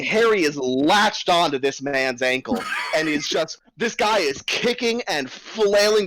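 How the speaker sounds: ordinary talk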